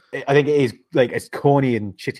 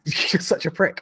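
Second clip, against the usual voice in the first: laughing; 7 semitones higher than usual. Real, not acted. Laughing at himself mid-story.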